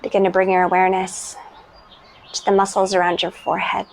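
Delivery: calm, unhurried meditation voice with long pauses; guided meditation. Real, not acted.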